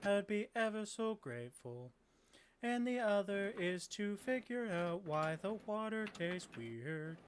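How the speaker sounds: slow, monotone robot greeting; courteous automaton, word by word; polite robot quest-giver. Acted. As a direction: speak in held, stepped machine tones. Robot quest-giver Lawrence gives tasks.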